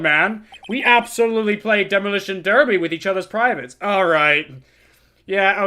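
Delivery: a hint of a rattled old man ranting; crude old lawyer. Old lawyer ranting about the girl, mildly worked up. Lawyer boasts, reacts loudly.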